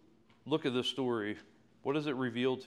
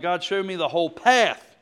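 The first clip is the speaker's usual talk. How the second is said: loud, higher exasperated plea to God; mock-frustrated outburst, raised voice; 6 semitones higher, 11 dB louder than usual. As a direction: demand it of God in acted frustration. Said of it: "I get so frustrated by this".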